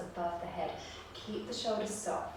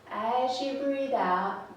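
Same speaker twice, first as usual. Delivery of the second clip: slow breath cue; 4 semitones higher, 5 dB louder, much slower than usual. Shown as a demonstration.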